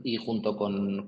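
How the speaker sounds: ordinary talk, nothing marked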